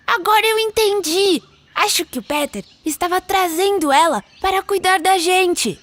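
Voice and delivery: put-on high-pitched boy working it out; excited boy figure. Lost boy tells the others what Peter meant, excited high voice. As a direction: voice a boy's eager realisation, pitched high.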